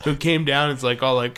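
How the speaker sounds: usual speaking voice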